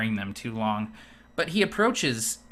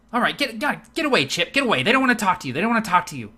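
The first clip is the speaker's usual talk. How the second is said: higher, louder, impatient shoo; shooing bartender; 9 semitones higher, 5 dB louder, much faster than usual. Acted. Elven bartender shooing Chip away from guests, impatient. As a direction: chase the pest off the table.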